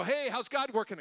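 high, loud call-out; loud hearty greeting. Acted. People coming up to ask the preacher, calling out loudly. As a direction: holler it like an acted eager greeter.

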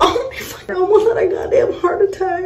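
very high squealed disbelief; acting out a near heart attack; high-pitched, breaking shock; shocked outburst. Retold shock at thrown shoes.

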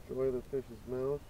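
ordinary talk, nothing marked

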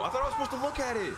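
shocked disbelief. Real, not acted. Shocked at dying in horror game.

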